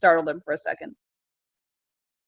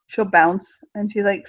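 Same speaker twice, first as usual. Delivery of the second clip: ordinary talk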